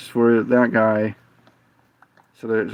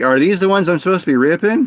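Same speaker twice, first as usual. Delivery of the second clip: higher, quick asking, lightly acted; retold unsure question; 6 semitones higher, faster than usual. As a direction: ask it high and hurried, imitating yourself.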